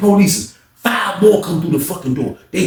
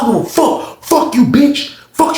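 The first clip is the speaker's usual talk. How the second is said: high, heated mock cursing; acted angry defiance; 12 semitones higher than usual. Inmate ignoring the warning, angry cursing. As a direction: spit defiance, pitched up.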